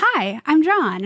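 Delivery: high, slow greeting in imitation; acted friendly introduction. Guest acting out the actor greeting her, imitated introduction. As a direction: draw out an imitated hello. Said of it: "he turns and he goes".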